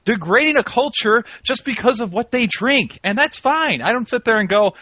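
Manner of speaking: high-pitched, indignant mock lecture. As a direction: scold him with a hammed-up lecture. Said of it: "lecture that I'm about to give".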